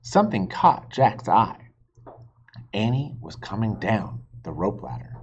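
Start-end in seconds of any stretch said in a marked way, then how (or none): none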